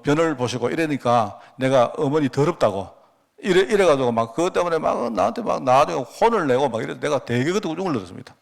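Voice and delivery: usual speaking voice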